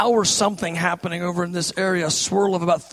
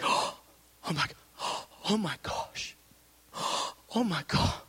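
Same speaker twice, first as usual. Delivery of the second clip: breathless gasps, hushed oh my God; retold gasping; 9 dB quieter than usual. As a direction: relive being overwhelmed, gasping for air. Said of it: "I'm gasping".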